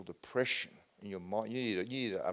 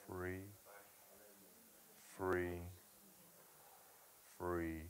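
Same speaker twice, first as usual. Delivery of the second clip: much softer and lower, almost whispered; hushed blessing after the storm; soft murmured prayer; 3 semitones lower, 7 dB quieter, slower than usual. Real, not acted. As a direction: breathe the words of peace over him. Softly praying over him.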